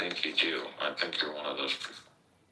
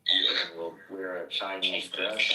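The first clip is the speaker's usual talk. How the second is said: ordinary talk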